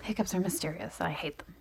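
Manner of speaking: hushed whisper. Real, not acted. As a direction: whisper it under breath. Hushed aside during pause.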